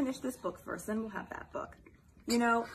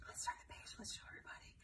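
whispering; 16 dB quieter, much faster than usual. Real, not acted. Whispered to child.

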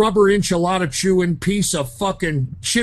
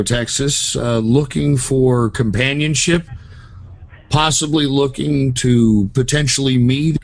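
lower, smooth personal-ad voice; deep dating-ad pitch; 5 semitones lower than usual. Acted. Caller pitching himself to the saloon clerk, lower and smooth. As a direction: sell yourself like a lonely-hearts ad.

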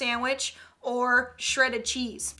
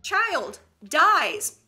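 ordinary talk

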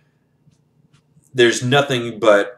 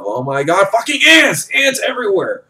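panicked yell; 9 semitones higher than usual. Retold or imitated. Soldier landing among swarming ants, panicked high yell. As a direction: shriek it like the bugs are right on you.

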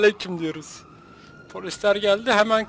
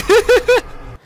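loud, high-pitched burst of laughter; laughing; 14 semitones higher, 7 dB louder than usual. Real, not acted. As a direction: crack up at your own joke. Gülme krizi, kargo şakası. (yüksek, tiz kahkaha)

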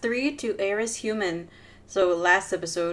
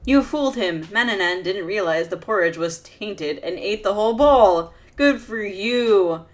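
louder, elated game announcement; triumphant victory read; 2 semitones higher, 7 dB louder than usual. A narrator's reading. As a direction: celebrate the win out loud. Reads victory text.

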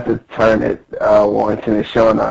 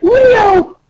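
very high squeaky call; hammed-up cartoon cry; crying princess; 4 dB louder than usual. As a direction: wail for a rescuer in a tiny voice. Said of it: "She's like".

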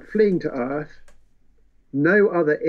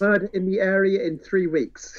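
gleeful Caldania; 5 semitones higher than usual. Retold or imitated. Voicing Caldania's gleeful remark.

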